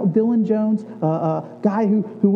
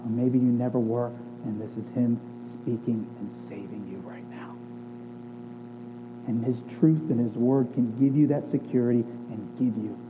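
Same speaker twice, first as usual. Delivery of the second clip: lower, softer earnest appeal; tender sermon close; 8 semitones lower, 7 dB quieter than usual. Real, not acted. Pastor reassuring his church, soft and earnest. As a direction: reassure the church gently, voice dropping low.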